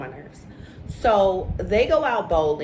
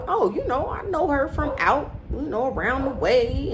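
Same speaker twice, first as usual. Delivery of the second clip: much higher, mock-evasive stalling; the man on the show, dodging where he knows her from, mock hemming; hemming and hawing; 7 semitones higher than usual. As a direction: hem and haw to dodge the question. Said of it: "start doing all of this hemming and hawing".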